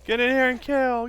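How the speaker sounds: high loud yell at zombies; shouting. Real, not acted. Yelling at zombies.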